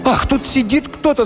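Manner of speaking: put-on high startled shriek of girls; frightened cry. A narrator's reading. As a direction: gasp it out in fright. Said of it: "закричали все и в испуге". (испуганный вскрик девушек, высоко)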